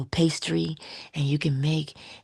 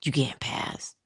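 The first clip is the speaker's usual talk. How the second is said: low, odd guard voice; pet bird voice; 4 semitones lower, 4 dB quieter than usual. Pretend. Voicing the pet bird guarding the stairs, low and odd. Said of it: "and he's like".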